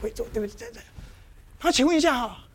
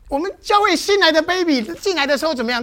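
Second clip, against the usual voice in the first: normal talking voice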